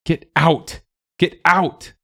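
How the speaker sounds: high-pitched narrated yell, stressing OUT. A narrator's reading. Willa driving the animals out, yelling high. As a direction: cry it out to drive them away. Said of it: "she yelled".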